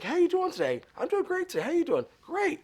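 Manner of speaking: very high, upbeat small talk; retold cheery greeting. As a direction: imitate two chipper strangers swapping greetings. Retold cheerful greeting between strangers.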